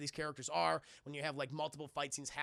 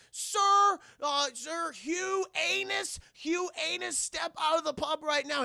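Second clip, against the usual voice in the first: much higher, loud officer shout; mock police call; 11 semitones higher, 8 dB louder, slower than usual. Police ordering Shaw out of the pub, loud mock shout. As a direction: bark orders like a cop outside. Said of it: "and I'm like".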